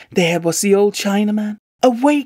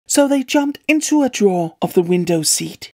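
plain reading voice

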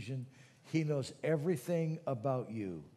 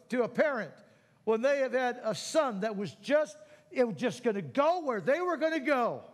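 higher, louder impassioned plea; fervent preaching; 10 semitones higher, 5 dB louder than usual. Acted. Pastor urging parents to pray for their sons, impassioned. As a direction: pour yourself into the prayer. Fervent prayer for wayward children.

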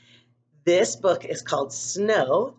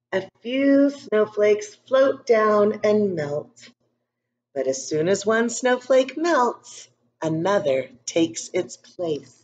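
put-on calm storybook narration for young kids; story reading; 3 semitones higher, slower than usual. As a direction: read it gently to a class of children. Reads picture book to class.

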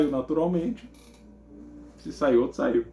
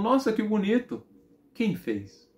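impressed mother; 8 semitones higher, slower than usual. Retold or imitated. Mother looking at her son's drawing, mildly impressed.

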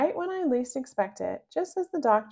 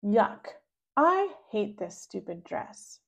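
usual speaking voice

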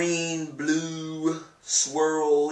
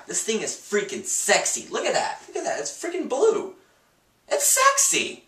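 excited; 10 semitones higher, 3 dB louder than usual. Real, not acted. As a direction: voice climbing higher and louder. Showing off the blue tie.

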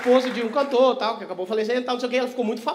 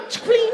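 slot jingle imitation; 11 semitones higher, 4 dB louder than usual. Imitates the slot game sound.

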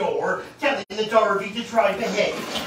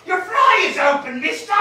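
much higher, loud jeering woman's voice; 11 semitones higher, 4 dB louder than usual. Acted. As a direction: jeer at him like a street woman.

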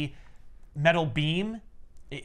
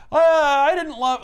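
much higher, louder, slow gushing; lovesick villain; 11 semitones higher, 7 dB louder than usual. Retold or imitated. Ivan confessing to Minka, high slow gush. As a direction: ham up a pining confession. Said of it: "Ivan is like".

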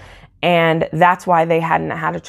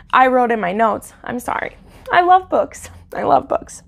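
high, choked voice breaking into whispers; real tearful crying; 6 semitones higher than usual. Real, not acted. Book lover apologizing to viewers for getting emotional, crying. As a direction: fight back tears, voice squeezing up high. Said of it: "get so dramatic and cry".

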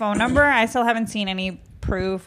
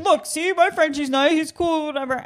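light mock defensive owner, higher; a higher put-on voice, defending his dog; imitating the stranger; 7 semitones higher than usual. Frenchie owner defending his dog, mock higher voice. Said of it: "He's like".

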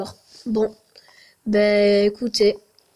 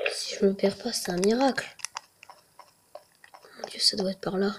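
nervous whisper; hushed and uneasy; 3 semitones lower, 9 dB quieter, faster than usual. Real, not acted. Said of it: "Je tremble de partout".

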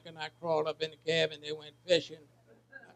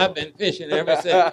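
louder, laughing through the punchline; 10 dB louder than usual. Real, not acted. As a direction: deliver the last line while chuckling.

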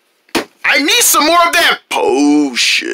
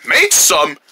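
normal talking voice

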